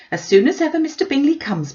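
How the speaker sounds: mother insisting she's wronged; fretful mother objecting; indignant Mrs Bennet. A narrator's reading.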